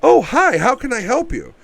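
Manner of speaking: high, chirpy customer-service voice; overly polite greeting. Retold or imitated. Mocking the husband greeting an intruder, high and sugary. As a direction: sing it out falsely friendly. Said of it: "the appropriate reaction isn't".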